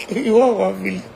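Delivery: laughing through the question; mocking laugh. Mocking the money request, laughing. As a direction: scoff with a laugh. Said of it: "mocking laugh at request".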